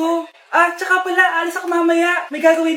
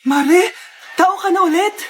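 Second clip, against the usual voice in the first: ordinary talk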